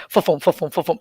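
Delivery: rapid muffled repeated fums; imitated toilet-paper roll. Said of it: "you hear the toilet paper".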